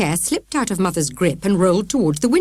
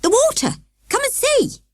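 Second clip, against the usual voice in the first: excited shout. A narrator's reading. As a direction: beckon them urgently. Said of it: "shouted Morgan".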